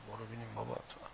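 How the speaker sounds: dismissive scoff at the objector; mock dismissal. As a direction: wave the critic away under your breath. Dismissing an imagined critic.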